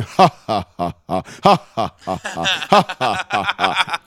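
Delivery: slow, deliberate fake laugh; staged laughter, one measured beat at a time; mock chanted laughter. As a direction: act out owners laughing knowingly. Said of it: "would just sit there going".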